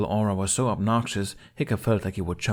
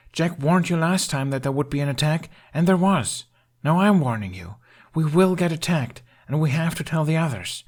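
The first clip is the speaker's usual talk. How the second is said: urgent Hiccup; 8 semitones higher, 4 dB louder than usual. A narrator's reading. Hiccup urgently warning father.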